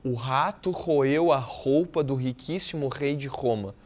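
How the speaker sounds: slow reading, dwelling on the rattling R sounds; slow, stressed R. Shown as a demonstration. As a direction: read slowly, rolling each R. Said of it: "mas tenta ler isso aí devagar". (leitura lenta, marcando cada R)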